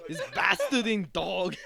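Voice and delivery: slow, laughing Irish accent imitation. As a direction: say it like an Irish teen reviewer. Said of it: "him just saying that in his Irish accent".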